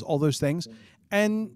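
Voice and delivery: usual speaking voice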